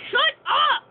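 loud high mock yell at the game; retold shout. Retelling how he yelled at the game, loud and high. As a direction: snap it out, exasperated. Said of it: "I was just like".

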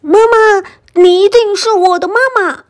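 put-on quick, high, childlike call. A narrator's reading. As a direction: cry out eagerly in a very high pitch. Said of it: "就追上去喊".

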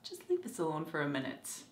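low, soft, fast menace; menacing Proctor. Retold or imitated. Proctor dismissing the others before a kill, low menace. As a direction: drop low and quiet like a threat. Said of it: "Proctor comes in and he's like".

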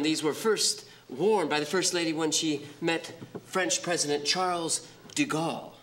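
normal talking voice

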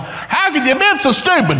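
acted-out angry parent; retold yelling. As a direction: scold in comic, high-pitched exasperation. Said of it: "I'm yelling".

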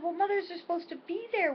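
high, whiny mock complaint; petulant daughter. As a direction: play the indignant grown child scolding mom. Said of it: "I said".

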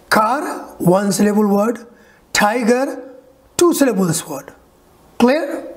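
usual speaking voice

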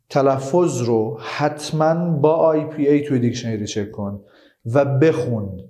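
normal talking voice

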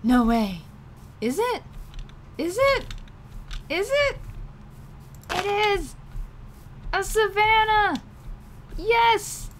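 high, loud, rising excitement; thrilled discovery. Real, not acted. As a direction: squeal with delight at the find.

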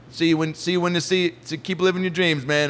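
mock awkward, over-keen sign-off; awkward goodbye. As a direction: trip over your parting words. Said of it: "very awkwardly and was like".

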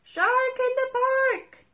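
high, loud bursting shout; shout fit to bust. A narrator's reading. Tim shouting his warning fit to bust, loud and high. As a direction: yell as hard as you can. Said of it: "He shouts ... till he's fit to bust".

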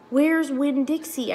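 high, loud, slow alarmed shout; girl shouting for her lost dog. A narrator's reading. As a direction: shout in sudden panic. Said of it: "I shouted".

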